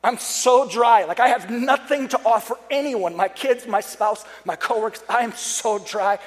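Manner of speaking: slow, drawn-out, emphatic plea; pleading prayer. Acted. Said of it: "I just begin to pray".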